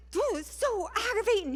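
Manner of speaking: high-pitched exasperated retelling; exasperated quarrel. Retold or imitated. Wife arguing over cleaning the garage, exasperated.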